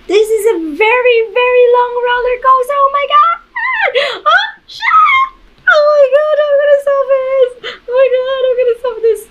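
very high frightened crying; real panic, near tears; panicked. Real, not acted. Panic on ride.

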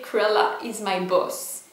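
slow English with a French accent; deliberate French accent. Shown as a demonstration. Vlogger showing viewers a sweatshirt slogan, deliberate French accent. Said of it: "Attention l'accent ... C'est fait exprès".

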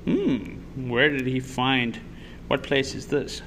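ordinary delivery

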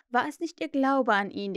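usual speaking voice

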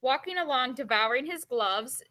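usual speaking voice

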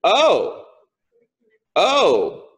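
spaced-out ohs as it sinks in; mock sudden understanding; dawning realization. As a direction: act out a revelation landing slowly. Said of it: "Paul got a revelation".

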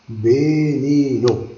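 Veneno stretched slowly, syllable by syllable; a slow classroom demo of Veneno; slow teaching demo. Shown as a demonstration. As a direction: draw Veneno out slowly for learners. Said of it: "teacher repeating word slowly".

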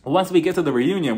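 usual speaking voice

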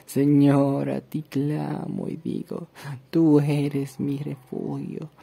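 slow, hushed psalm reading; solemn scripture reading. Acted. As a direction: read the psalm as a prayer, unhurried. Reads a psalm aloud.

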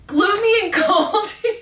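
high, loud, cracking up laughing. Real, not acted. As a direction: giggle through the words. Repeats word, cracking up.

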